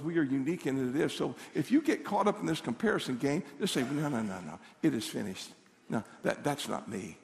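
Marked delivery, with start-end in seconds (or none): 3.8-5.5 low, soft self-reminder; quiet declaration. Shown as a demonstration. Answering the comparison game, quiet low declaration. 5.9-7.2 very low, soft dismissal; quiet refusal. Shown as a demonstration. Refusing the comparison game, quiet low self-talk.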